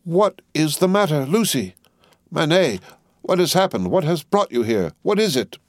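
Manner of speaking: high-pitched, bewildered volley of questions; agitated, voice pitched right up; breathless and confused. A narrator's reading. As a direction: demand answers in confused alarm. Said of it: "breathless and confused".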